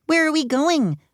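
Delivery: high-pitched schoolgirl question; eager pupil asking. A narrator's reading. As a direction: pipe up with a question for teacher. Pupil asks teacher about trip.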